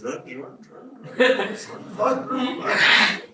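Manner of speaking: half-voiced, laughing urging; mock urging. Armchair cricket fan urging batsmen on, playful urging. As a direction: urge the runners on, pitch climbing.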